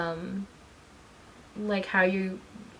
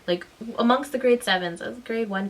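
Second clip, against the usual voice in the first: normal talking voice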